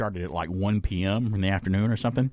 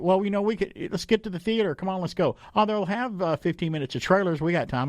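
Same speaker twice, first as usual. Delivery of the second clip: mock casual friends; 9 semitones higher than usual. Friends urging, retold.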